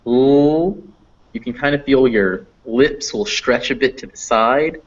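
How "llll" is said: L drawn out as a higher "llll"; held L sound. Shown as a demonstration. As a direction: sustain L with the air flowing out the sides. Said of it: "Then if you go".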